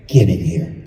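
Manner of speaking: low, ominous mother's summons; mother catching a son sneaking in at four; stern mother. Retold or imitated. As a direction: growl it low from the dark. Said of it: "and then you hear".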